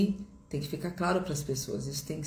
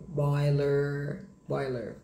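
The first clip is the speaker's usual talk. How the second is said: American "boiler" with a heavy countrified R; americano bem acaipirado; much slower than usual. Shown as a demonstration. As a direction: lean on the final American R of "boiler". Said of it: "bem acaipirado". (sotaque americano, R bem caipira)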